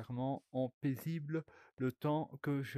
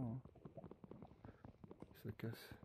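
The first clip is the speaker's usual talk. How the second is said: normal talking voice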